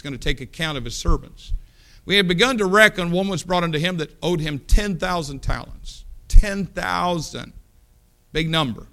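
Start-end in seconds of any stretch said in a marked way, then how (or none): 2.0-7.5 preaching scripture. Acted. Reading scripture with force.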